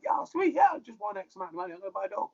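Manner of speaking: high, excited self-quote; giddy with the prize money. Retold or imitated. Retelling own excited thoughts.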